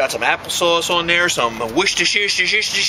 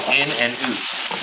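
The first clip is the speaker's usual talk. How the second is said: slow, lower Canadian "oot" for out; mock Canadian accent; 6 semitones lower, 4 dB quieter, much slower than usual. Shown as a demonstration. Showing how you speak Canadian, mock Canadian accent. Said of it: "that's how you speak Canadian".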